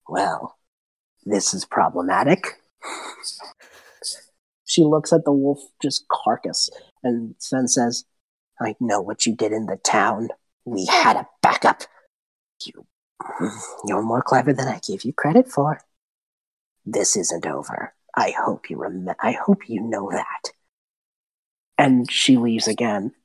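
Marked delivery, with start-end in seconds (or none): 0.1-2.6 low, quiet hag voice; low, subdued villainous aside; displeased hag. Acted. Hag's spirit reappears, displeased. 8.6-20.5 low, quiet threat from a beaten hag; a hint of villain, sinking low and soft by the end; lightly voiced menacing hag. Game master voices the defeated hag.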